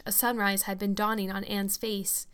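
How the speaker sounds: ordinary delivery, nothing marked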